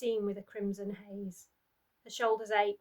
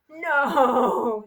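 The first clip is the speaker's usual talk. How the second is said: put-on a mother screams as her child is hurt; anguished scream; 3 semitones higher, 13 dB louder than usual. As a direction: scream in horror. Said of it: "she screamed".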